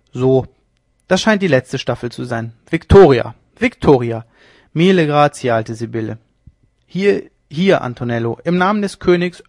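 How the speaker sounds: loud, exultant cries; triumphant shouting. Acted. Leone reaching the top floor, triumphant shout. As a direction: crow your victory up the stairs.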